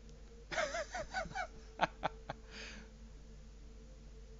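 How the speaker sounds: long soft squeaky giggle; high-pitched giggle. Real, not acted. Laughing at chat.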